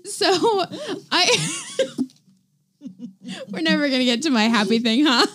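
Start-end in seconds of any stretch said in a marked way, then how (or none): none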